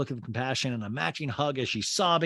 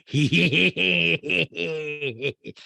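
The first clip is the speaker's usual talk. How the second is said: laughing; 4 semitones higher, 5 dB louder than usual. Real, not acted. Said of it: "I went lighter".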